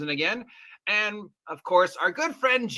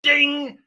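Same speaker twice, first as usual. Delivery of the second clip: bell ding; 6 semitones higher, 5 dB louder than usual. Acted. Wheel bell sound.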